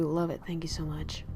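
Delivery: soft, breathy whisper of thanks. Real, not acted. Whispering thanks to a chatter for the compliment. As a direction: whisper it close to the mic.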